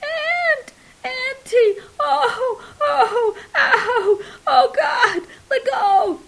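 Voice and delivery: very high, loud wailing sobs of pain; sobbing. A narrator's reading. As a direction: sob and howl, begging to be released. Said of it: "Kopinski's dad sobbed".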